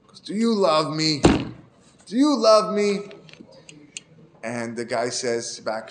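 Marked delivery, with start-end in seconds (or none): 0.2-3.1 put-on slurred, drawn-out drunk pleading, the second time higher and slow; a tipsy villager begging his friend. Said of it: "he says in a drunk voice".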